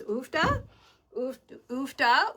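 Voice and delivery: trying out an unknown word, rising at the end; puzzled questioning. Real, not acted. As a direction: sound it out as a baffled question. Trying out a word she doesn't know.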